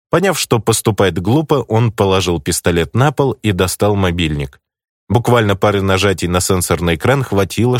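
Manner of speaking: usual speaking voice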